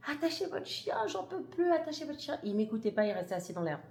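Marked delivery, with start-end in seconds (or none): 0.1-2.4 much higher, frantic cry at the owner; plead-yell at him to leash the dog, voice jumping up; retold high-pitched yell. Mother crying out to the dog owner, a high frantic yell. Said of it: "Je commence à crier".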